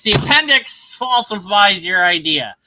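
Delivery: punched loud, the appendix hammered; loud rebuttal. Real, not acted. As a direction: shout it straight at the screen. Shouting rebuttal at the video.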